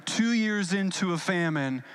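usual speaking voice

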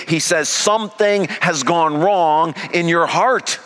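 high, loud, driving sermon voice; full-force preaching. Acted. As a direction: preach it out with rising fire.

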